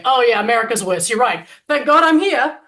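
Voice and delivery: loud mocking reply, rising to the end; mocking how an incarcerated person would answer; sarcastic mock quote.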